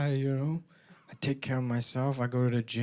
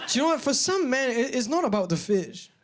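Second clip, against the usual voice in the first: emphatic; 7 semitones higher than usual. Real, not acted. Emphatic build-up.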